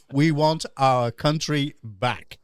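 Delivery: slow, spaced-out slogan; measured, emphatic restating; deliberate correction. Shown as a demonstration. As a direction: spell it out word by word for someone who misheard.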